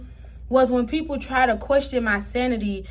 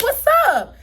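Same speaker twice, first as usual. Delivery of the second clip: higher, louder what's up; challenging call; 10 semitones higher, 6 dB louder than usual. Retold or imitated. Narrator daring the woman at the door, loud and high. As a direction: square up with a taunt. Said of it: "So I said".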